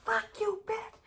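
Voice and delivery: very high, softened imitation yell; small high-pitched pretend scream; mock angry outburst. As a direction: squeal the insult quietly, imitating someone furious. Imitates the angry neighbor's insult.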